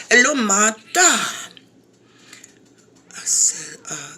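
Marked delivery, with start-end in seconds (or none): 0.1-1.1 lightly voiced brother, a touch higher and louder; half-hearted impression of a sweet-talking caller; imitating the brother. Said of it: "I'm gonna try to talk like him".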